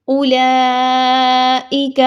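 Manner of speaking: اولئک slow, no waw sound after the hamza; correct ulaa'ika. Shown as a demonstration. Teacher showing the correct reading, slowly. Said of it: "بہت سے لوگ اس word کو غلط پڑھتے ہیں".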